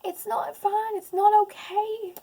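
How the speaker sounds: real crying, voice cracking high. Real, not acted. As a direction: it bursts out through sobs. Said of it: "Sorry, guys".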